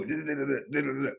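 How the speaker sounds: rapid repeated syllables, lightly mocking; mimicked stuttering. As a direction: imitate a stutter on one syllable. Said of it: "Stuttering little".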